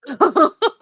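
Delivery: loud, high delighted laugh; high burst of laughter. Real, not acted. Cohost imagining Ilona on Traitors, loud high laugh.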